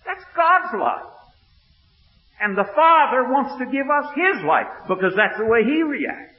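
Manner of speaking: loud, high, driving sermon voice; fired-up revival preaching; preaching at full force. Acted. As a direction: preach it to the back pew.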